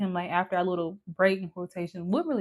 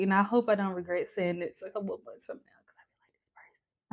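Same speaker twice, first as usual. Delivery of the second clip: whisper; 6 dB quieter, faster than usual. Real, not acted. Confessing hopes about new guy.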